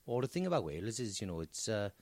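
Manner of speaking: put-on low, soft South Wales accent; southern Welsh accent. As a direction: explain softly in a southern Welsh lilt. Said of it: "I think this is more southern".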